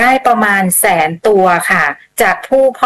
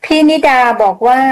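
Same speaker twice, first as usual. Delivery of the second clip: ordinary talk, nothing marked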